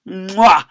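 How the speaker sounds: loud smacking mwah; comic kiss sound; blown kiss. Acted. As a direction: blow a big kiss.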